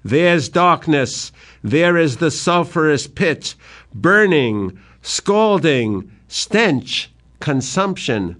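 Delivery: slow, high, disgusted outburst; revulsion. Acted. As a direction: spit each word out in revulsion. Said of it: "in real revulsion".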